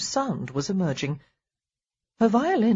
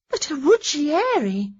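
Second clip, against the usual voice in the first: high, slow astonished cry; cried out; 9 semitones higher, 4 dB louder than usual. A narrator's reading. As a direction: exclaim in disbelief. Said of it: "Daisy cried".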